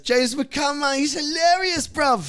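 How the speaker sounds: very high shouted London fan; raving London bloke, hammed-up; London accent. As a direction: shout it like a hyped London lad. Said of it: "these London dudes".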